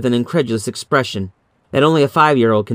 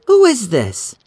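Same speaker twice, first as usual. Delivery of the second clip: a mild demanding question, higher; a touch of demand; 11 semitones higher, slower than usual. Acted. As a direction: press the silent caller for an answer. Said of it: "she demanded".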